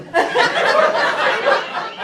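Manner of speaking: airy high-pitched giggle; high breathy laugh. Real, not acted. Laughs at own joke.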